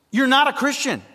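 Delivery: preacher to works-minded hearers, punching NOT; emphatic declaration. Real, not acted. Preaching point at full force.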